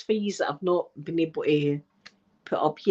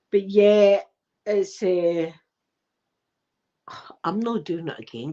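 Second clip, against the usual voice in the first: normal talking voice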